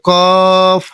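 কফ very slow and drawn out; letter demo. Shown as a demonstration. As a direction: stretch কফ slowly. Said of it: "এভাবে উচ্চারণ করতে হবে".

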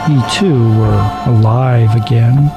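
plain reading voice

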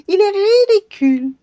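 high, loud cackling mockery; sneering goblin. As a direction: jeer at him with laughter.

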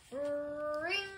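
sustained ringing call; imitated phone ring. A narrator's reading. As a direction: hold it out like a telephone bell.

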